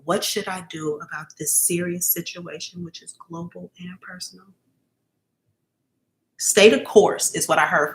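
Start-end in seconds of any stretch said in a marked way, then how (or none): 2.8-4.5 whispered, voiceless repeat of the question; hushed reread of the question. Acted. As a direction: breathe the question out in a hush. Reading a viewer's question.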